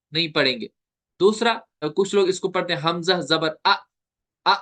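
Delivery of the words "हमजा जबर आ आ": हमजा जबर आ with the आ cut off abruptly; sudden stop. Shown as a demonstration. Teacher imitating readers who jerk, आ stopped suddenly. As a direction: chop the final आ short with a jerk. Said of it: "वहां पे अचानक रुक जाते हैं".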